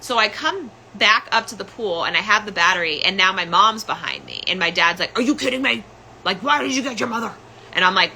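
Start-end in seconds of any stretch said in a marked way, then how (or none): 5.2-7.3 pitched-up imitation of an angry father; retold screaming. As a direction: imitate dad's exasperated outburst. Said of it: "being cursed at and screamed at".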